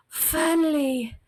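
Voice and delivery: mock relief at last; relieved finally. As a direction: stretch it like the long wait is over. Finally finding sweet tea.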